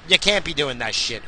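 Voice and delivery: usual speaking voice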